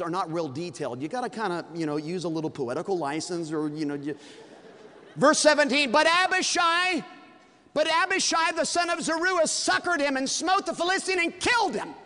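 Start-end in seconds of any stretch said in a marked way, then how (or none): 5.1-11.9 high, loud scripture reading; high, loud pulpit proclamation; preaching at full force. Acted. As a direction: proclaim the verse like a climax. Reads the verse in full force.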